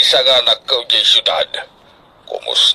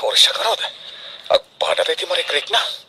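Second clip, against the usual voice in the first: unvoiced whisper, much softer; whispering aside; 8 dB quieter, faster than usual. Father whispers to the other girl about Risa, hushed whisper. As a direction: whisper it so Risa can't hear.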